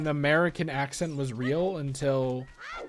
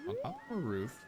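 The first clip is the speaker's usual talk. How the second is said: ordinary delivery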